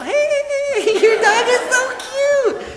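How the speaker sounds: very high, loud, drawn-out holler; yelling out the window. Retold or imitated. Neighborhood mom stopping in the street to admire a dog, yelling. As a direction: imitate a gushing long-distance shout, stretched and squealed. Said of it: "she's yelling out the window".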